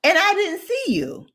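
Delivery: long high 'um', then pointed protest; pointed disbelief. Retold or imitated. Answering the man locked outside, pointedly disbelieving. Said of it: "And so I'm like".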